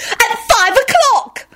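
very high, drawn-out squeal; gleeful high-pitched proclamation; excited yell. A narrator's reading. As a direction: squeal it slowly in triumph. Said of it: "she yelled and clapped her hands excitedly".

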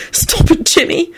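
high, would-be stern scolding. A narrator's reading. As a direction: scold her, shoulders shaking. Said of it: "Ron told her sternly".

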